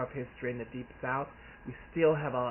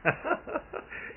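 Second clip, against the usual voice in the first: high laugh. Real, not acted. Laugh in mid quote.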